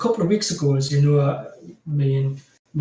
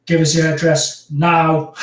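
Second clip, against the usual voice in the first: louder, playful retold demand; mock insistence; 6 dB louder than usual. [name] brushing off refusal to send something, mock insistence. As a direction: demand it, leaning on the last word.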